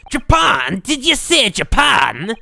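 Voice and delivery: put-on loud, surprised Scottish accent, slowing on the question; disbelieving Scottish governor hearing Japan; incredulous Scottish. Governor shocked that the lawyer is from Japan, loud Scottish disbelief.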